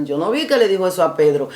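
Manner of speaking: usual speaking voice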